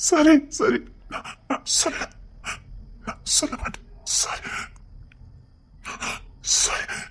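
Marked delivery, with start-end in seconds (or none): 1.1-7.0 unvoiced whisper; whispered promise. Husband whispers he won't say it to his wife. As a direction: murmur the promise under breath.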